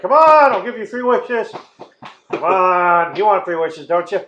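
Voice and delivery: loud, high, mock pleading; hammed-up desperate bargaining; wheedling trapped genie. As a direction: coax and beg to be let out. Genie bargaining after being refused.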